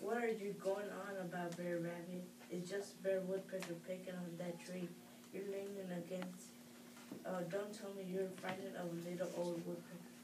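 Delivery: usual speaking voice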